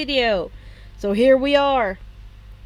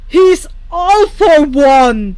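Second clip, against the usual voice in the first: slow, much louder outraged yell; furious shout; 3 semitones higher, 12 dB louder, slower than usual. Acted. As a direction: bellow it in disbelief and fury. All Might furious at Netsu.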